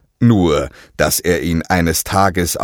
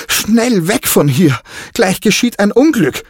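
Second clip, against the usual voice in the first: much higher, louder, panicked cry; frightened shout; 13 semitones higher, 3 dB louder than usual. A narrator's reading. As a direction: yell a warning in fear. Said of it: "schrie Diego verängstigt".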